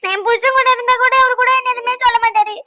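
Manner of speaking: tearful, wailing complaint; self-pitying fake-cry whine; put-on crying. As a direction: play up the tears to win sympathy. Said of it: "இந்த அழுது drama பண்ற வேலை".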